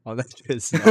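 usual speaking voice

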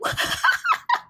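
airless high giggle; real breathless laugh; breathy laugh. Real, not acted. Laughing at own story.

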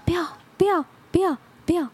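high pleading sleep talk. Retold or imitated. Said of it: "那个说梦话那个同学".

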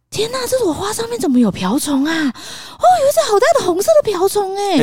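hammed-up surprise to lure a toddler; mother acting amazed at a ladybug to distract her son; play-acted amazement. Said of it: "我这边演戏说：".

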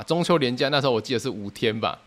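usual speaking voice

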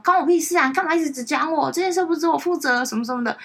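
imitating a defensive teammate snapping back; mock angry retort. Team leader mimics a member dodging blame, high mock snap. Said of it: "或者你只会骂说".

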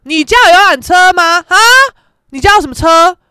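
loud cocky demand; mock challenge. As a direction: challenge them like a playground braggart. Acted boastful car-size argument.

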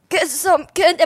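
put-on loud, coughing old-lady voice; sick old voice. Shown as a demonstration. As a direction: cough first, then croak it. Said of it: "I don't wanna sound sick".

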